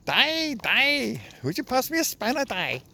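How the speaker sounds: sing-song mock Welsh lilt; mock Welsh accent. Vlogger mocks the Welsh to his viewers, hammed-up Welsh accent. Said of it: "Why do they talk like that? That's not even Welsh".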